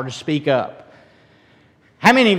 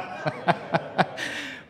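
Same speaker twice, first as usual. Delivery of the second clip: soft, voiceless laughter; amused chuckle; 3 semitones higher, 9 dB quieter than usual. Real, not acted. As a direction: chuckle after your own joke. Laughs after banana plant joke.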